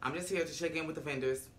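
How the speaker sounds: quiet, curt imitation; low-key, businesslike brush-off; short with her. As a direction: answer softly, giving as little as possible. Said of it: "Condola is really short with her".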